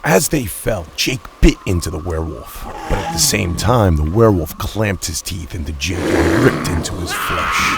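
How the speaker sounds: loud, driving climax narration; dramatic action shout. A narrator's reading. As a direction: hurl the words out as they fall. Leap and fall fight scene.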